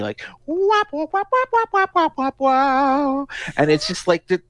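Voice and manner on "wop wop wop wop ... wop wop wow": very high, warbling calliope toots; imitated calliope. Host imitating the song's eerie calliope, very high. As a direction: mimic a freaked out calliope organ. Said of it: "freaked out calliope".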